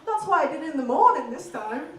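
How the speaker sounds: loud Yorkshire fella; Yorkshire accent. Retold or imitated. Yorkshire man explaining his second go, in a Yorkshire accent. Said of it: "I met a fella from Yorkshire".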